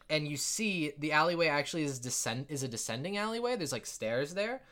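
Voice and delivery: ordinary talk, nothing marked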